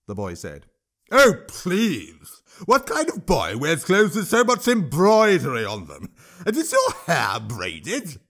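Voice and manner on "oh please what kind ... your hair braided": high, louder troll scorn; a troll scoffing to the crowd; scornful troll. Acted. Troll ridicules the boy.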